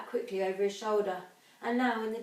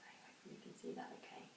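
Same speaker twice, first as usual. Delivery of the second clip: whisper; 21 dB quieter, much faster than usual. Real, not acted. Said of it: "aside to pupils".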